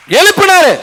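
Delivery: sudden high shout lifting the word; shouted proclamation; excited shout. Acted. Preacher acts raising the lame man.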